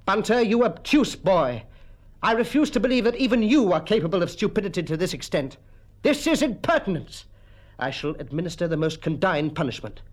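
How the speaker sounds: indignant schoolmaster. Acted. Mister Quelch scolds Bunter over his translation, stern and indignant. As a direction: tell the pupil off in lofty indignation.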